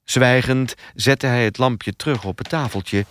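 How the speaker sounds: ordinary delivery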